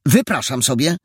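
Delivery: affronted, high-pitched objection; indignant protest. A narrator's reading. Inspector Perełka protests being insulted, indignant. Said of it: "oburzył się inspektor Perełka".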